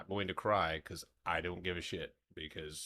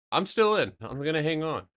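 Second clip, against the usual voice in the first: excited; 5 semitones higher, 9 dB louder than usual. Real, not acted. Excited holding trade.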